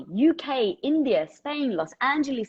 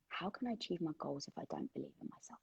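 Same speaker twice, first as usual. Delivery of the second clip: murmured softly to herself, pondering; quiet musing; 6 semitones lower, 23 dB quieter than usual. Real, not acted. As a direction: say it under your breath while thinking it over. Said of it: "take a second to really think this through".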